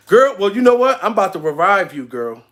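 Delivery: ordinary talk, nothing marked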